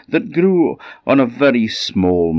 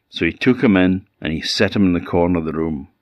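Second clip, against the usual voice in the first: ordinary delivery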